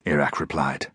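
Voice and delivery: ordinary delivery, nothing marked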